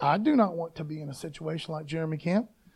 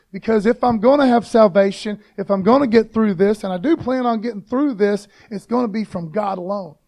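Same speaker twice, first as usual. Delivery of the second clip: normal talking voice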